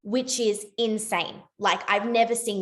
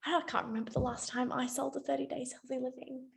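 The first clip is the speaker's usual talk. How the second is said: quiet aside; 3 semitones higher, 11 dB quieter than usual. Retold or imitated. Quoting her own quiet aside.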